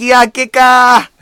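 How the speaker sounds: high, loud, slow hammed-up lament; put-on voice. Host reacting to a draw, high and hammed-up. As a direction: drag it out in mock disappointment.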